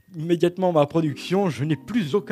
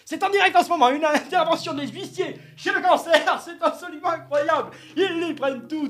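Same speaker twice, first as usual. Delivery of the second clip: high, giggling breaking news; hammed-up news flash; mock live report; 10 semitones higher than usual. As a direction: report it live while laughing.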